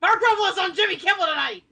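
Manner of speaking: high, loud excited announcement. Real, not acted. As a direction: burst out with the news. Excited news blurted out.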